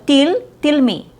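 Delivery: "til me" with tight, high EE; mispronounced tell. Shown as a demonstration. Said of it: "is not accurate".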